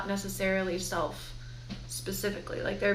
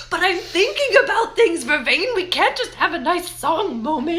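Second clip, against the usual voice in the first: higher and louder, playing her own fretting thoughts; mock frantic inner voice; 6 semitones higher, 6 dB louder than usual. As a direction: act out your overthinking brain, hammed-up. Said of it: "I'm just like".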